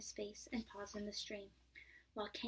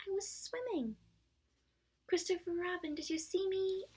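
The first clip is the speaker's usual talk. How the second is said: much higher, louder little-kangaroo squeak; squeaky Roo; 7 semitones higher, 4 dB louder than usual. Acted. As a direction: squeak it out proudly. Roo boasting to everyone.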